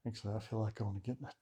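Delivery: puffing after a brisk walk; out of breath. Real, not acted. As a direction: talk while still winded. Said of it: "You sound out of breath".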